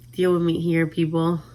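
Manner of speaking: ordinary delivery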